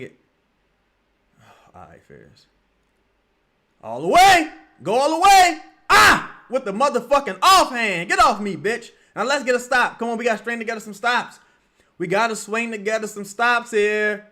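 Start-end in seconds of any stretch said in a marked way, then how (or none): 3.8-8.9 loud, high screaming for the drive; real cheering shout. Real, not acted. 9.1-14.3 loud urging. Real, not acted. Urging defense after the score.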